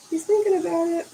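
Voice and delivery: cooing aside to the cat. Acted. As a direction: say it again to the cat, teasingly. Same line to the cat.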